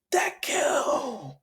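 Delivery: high, drawn-out name, imitated; acted-out strained high cry; angry yell. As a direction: yell it high at a rival, stretched. Bakugo yelling on arrival.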